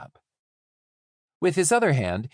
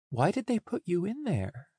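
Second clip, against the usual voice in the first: higher, tentative question; nervous; 7 semitones higher, 3 dB quieter than usual. A narrator's reading. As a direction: ask with a touch of nerves, pitched up. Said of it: "I could tell that I sounded nervous".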